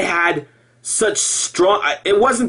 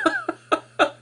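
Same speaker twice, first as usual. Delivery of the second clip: sudden squeaky laugh; real giggle at his own joke; high burst of laughter; 5 dB quieter than usual. Real, not acted.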